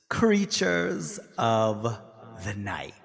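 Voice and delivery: breathy vintage purr. Acted. Drawn-out show title.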